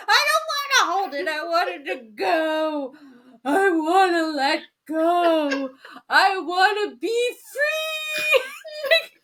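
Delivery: high, loud mock wail, slowing into long drawn-out words; hammed-up screaming breakdown. Retelling a woman's breakdown on a hike, screaming tearful wail. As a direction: build into an overblown crying scream.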